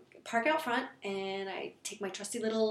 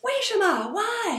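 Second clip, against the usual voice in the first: indignant shout; 11 semitones higher, 8 dB louder, slower than usual. Retold or imitated. About to confront the thief.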